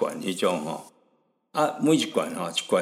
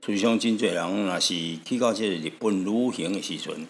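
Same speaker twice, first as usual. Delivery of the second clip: normal talking voice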